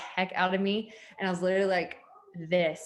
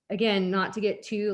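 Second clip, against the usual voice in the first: ordinary talk, nothing marked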